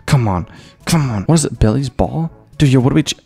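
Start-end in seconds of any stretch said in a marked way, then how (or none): none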